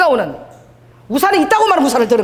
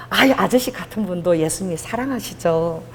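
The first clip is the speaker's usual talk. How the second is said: smiling reply; 4 semitones lower than usual. Retold or imitated. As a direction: soothe the angry man kindly. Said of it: "내가 웃으면서".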